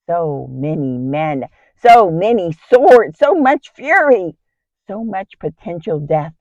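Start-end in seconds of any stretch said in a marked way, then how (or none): 1.8-4.4 louder, higher, climbing with each phrase; acted dread. Wife facing an army of swords, rising dread.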